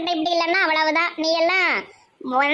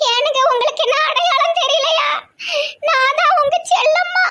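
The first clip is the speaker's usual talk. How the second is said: crying grandma; 8 semitones higher than usual. Acted. Shaved wife pleads with her husband, high tearful wail. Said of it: "பாவம் அழுதுட்டு இருக்கு".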